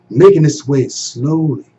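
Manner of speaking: usual speaking voice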